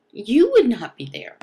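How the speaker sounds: normal talking voice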